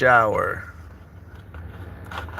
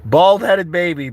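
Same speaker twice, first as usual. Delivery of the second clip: teasing quote; 6 semitones higher, 6 dB louder, slower than usual. Retold or imitated. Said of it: "Brianna says".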